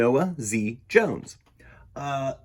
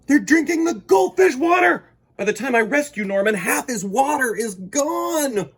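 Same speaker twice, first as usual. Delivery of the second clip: much higher and louder, shouted alarm; alarmed storytelling; 11 semitones higher, 7 dB louder than usual. Acted. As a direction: cry out the danger to the fish. Alarm at dogs drinking water.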